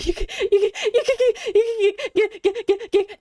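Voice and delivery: high, wailing fake sobs through the words; mock hysterical sobbing. Vlogger fakes sobbing at the nurse about the shot. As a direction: ham up a blubbering breakdown. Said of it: "No, I'm just kidding. I wasn't in hysterics".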